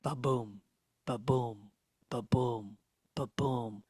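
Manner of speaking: low, soft, evenly spaced thumps; imitated ominous heartbeat. Narrator voicing the underwater sound of a nearby shark, ominous. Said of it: "we start hearing".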